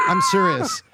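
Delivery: laughing squeal. Real, not acted. Laughing while insisting, squeals.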